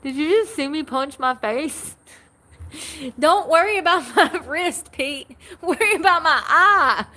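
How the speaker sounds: high, loud and breaking with laughter; real giggling after hitting her own eye; laughing through pain. Real, not acted. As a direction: laugh helplessly while begging for help. Just punched herself in face.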